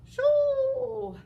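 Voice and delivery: loud whistling snore; a big sleepy snore; giant snore. Acted. Giant falls asleep.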